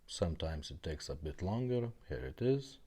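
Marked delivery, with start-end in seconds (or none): none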